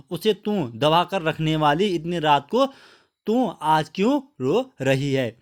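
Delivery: ordinary talk, nothing marked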